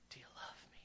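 whispered question, barely breathed. Acted. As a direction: ask it softly in a hush. Jesus asking third time.

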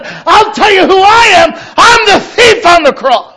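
high, loud and slow, crying out; emotional shout. Real, not acted. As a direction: cry it out with full conviction. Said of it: "while I'm already getting emotional".

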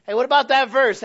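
loud high nudge; mock prodding call. Preacher voices the Spirit nudging a believer, loud and high. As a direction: call out a reminder.

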